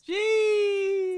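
sudden high exclaimed Jeez; surprised shout. Real, not acted. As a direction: blurt it out in disbelief. Reacting to rival's claim.

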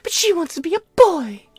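very high-pitched imitation of women; exaggerated falsetto parody; mocking women's voice. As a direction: put on a high, gushy mock-female voice. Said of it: "Women are gonna look at it like".

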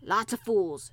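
broad Yorkshire scolding, loud opening; old gardener's forceful country talk; gruff old Yorkshire gardener. A narrator's reading. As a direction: scold the lad in thick dialect.

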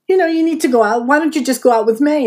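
a hint of a pushy wife urging John; lightly voiced pushy wife. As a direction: push him lightly toward a date.

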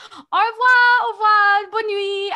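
high, loud sing-song French farewell; Chloe's sing-song goodbye. Retold or imitated. Friend fleeing a stranger, singing a bright French goodbye. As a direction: lilt a cheery French goodbye while fleeing. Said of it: "Chloe goes".

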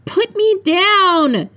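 high, long-drawn squealing shout; very high, playful-protest yell. A narrator's reading. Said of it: "she shouted".

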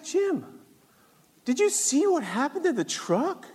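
lightly voiced dad, pitched well up; high, swooping question as dad; retold concerned dad. Dad asks son about the damaged truck, high retold concern.

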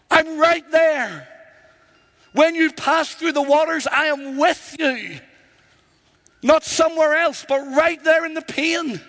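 preaching at full force. Acted. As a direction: drive it home to the congregation. Preaching climax on God's presence.

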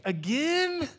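high, loud fed-up "Again?"; mock exasperated God, high and loud.

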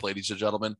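usual speaking voice

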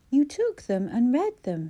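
slow, sharp and accusing. A narrator's reading. Accusing Clara of reading the diaries, sharp and slow. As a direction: bite out each word coldly. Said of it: "he said sharply".

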